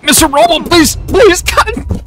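high desperate begging; high pleading. Real, not acted. Pleading with game character.

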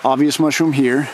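ordinary talk, nothing marked

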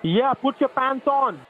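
slow, loud, firm command; exasperated order. Real, not acted. Husband ordering his wife to dress, slow loud command. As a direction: lay down the law, every word weighed.

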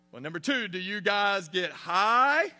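hammed-up boy preacher drawing it out; mock preacher. Seventh grader preaching at his homeroom class, mock sermon.

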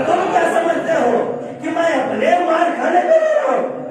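high-pitched mock-tearful protest; Bahlol. Weeping Bahlol repeats his answer to Harun, acted high-pitched protest. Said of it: "जनाब बहलोल रोते रहे".

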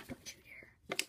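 breathy playful whisper; whispered threat. Acted. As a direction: hiss it like a secret warning. Mock threat to viewers.

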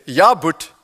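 a hint of Joburg accent, challenging; hint of a Joburg accent, heckling. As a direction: lightly do a gruff Joburg player heckling. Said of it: "a Joburg accent said".

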